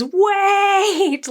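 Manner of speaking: high, desperate shout to stop it; desperate scream. Retold or imitated. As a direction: scream it out to halt everything. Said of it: "Egg screams".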